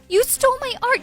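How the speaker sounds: loud, high, furious cry with ART hit hard; furious accusation. Acted. Daughter accusing her mother to her face, furious.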